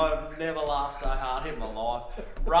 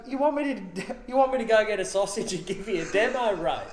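mock offer, laughing; 7 semitones higher, 4 dB louder, faster than usual. [name] teasing, laughing.

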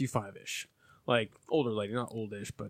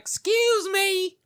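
high, drawn-out mock summons; old lady customer; 5 dB louder, much slower than usual. Diner calling the cashier back, high drawn-out imitation. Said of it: "This old lady comes up".

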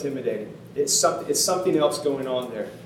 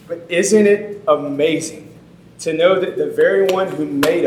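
preaching at full force; 4 semitones higher, 7 dB louder than usual. Acted. Pastor drives the story's point home to the congregation, fervent preaching. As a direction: build to a passionate pulpit climax.

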